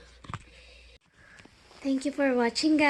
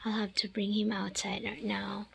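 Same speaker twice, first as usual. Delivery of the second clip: hushed whisper; 3 semitones lower, 9 dB quieter than usual. Real, not acted. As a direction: whisper it so the dog won't hear. Whispering to not alert dog.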